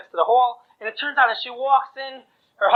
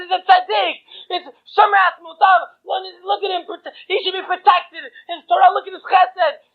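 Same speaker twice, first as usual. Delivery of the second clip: fervent pleading; 8 semitones higher, 3 dB louder than usual. Acted. Bride pleads for her husband.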